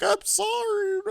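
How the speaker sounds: high squeaky mock-pleading voice; squeaky apology. Beaten woman pleads with landlady, high squeaky sorry. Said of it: "she's like".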